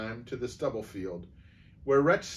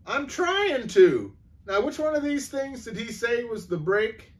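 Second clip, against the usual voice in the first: fumbling for the brake in a panic; panicky; 11 semitones higher, 5 dB louder than usual. A narrator's reading. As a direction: Eddie panicking on the hill.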